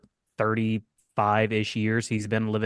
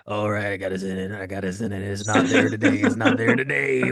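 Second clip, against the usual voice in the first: failing to find Buddy's voice; 3 semitones lower than usual. Acted. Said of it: "Trying to warm up".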